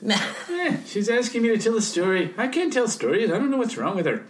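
jokey Irish brogue; Irish accent. Pretend. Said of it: "that was my Irish accent".

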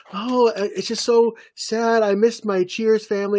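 hammed-up sorrow, pitched high; mock sadness. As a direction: play up a pretend wistful monologue. Acts out a sad monologue.

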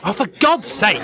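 high exasperated snap, a little slow; exasperated outburst. Acted. Fitz losing patience with Sophie, exasperated outburst. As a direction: throw up your hands in frustration. Storms off in frustration.

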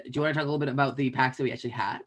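usual speaking voice

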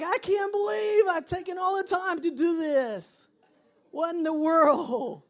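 high-pitched, hammed-up exasperation; frustrated parent. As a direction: wail your disbelief like a fed-up parent. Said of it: "And you're frustrated".

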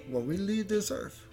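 normal talking voice

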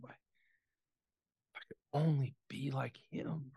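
slow, soft whisper; a wistful wish whispered to oneself; inner thought. Retold or imitated. Boy thinking to himself about his father, whispered. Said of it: "I just remember thinking to myself".

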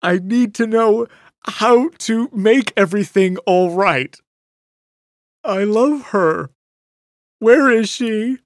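high, loud pleading whimper. Acted. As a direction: beg through tears. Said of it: "Bert whimpered".

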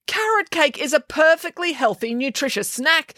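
high defensive protest; arguing. A narrator's reading. Headmaster defends the carrot cake, mild high protest. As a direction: argue back, a touch defensive. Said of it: "argued the headmaster".

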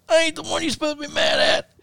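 punched opponent protesting to his attacker, high and whiny; mock hurt protest. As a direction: complain like the wrong guy got punched. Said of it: "he goes".

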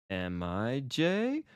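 "Am I Jay?" drawn out slowly, climbing to the end; rising intonation. Shown as a demonstration. Teacher showing English question tune, "Am I Jay?" rising at the end. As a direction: let "Jay" rise clearly as a question. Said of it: "we have that rising intonation".

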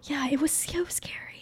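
hushed unvoiced whisper. Real, not acted. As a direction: whisper it under the talk. Whispered aside.

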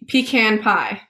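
"pecan" said PEE-can; chosen pronunciation. Shown as a demonstration. Guest confirming which one was said, "pecan" as PEE-can. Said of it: "I said pe".

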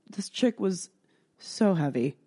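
ordinary talk

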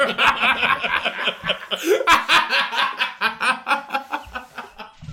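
long high-pitched laughing fit; helpless sustained laugh; real laughter. Real, not acted. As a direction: crack up completely, trailing out slowly. Cracking up at punchline.

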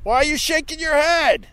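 angry protest. Real, not acted. Snapping back at the dog owner, angry and high. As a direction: demand it, outraged and shrill.

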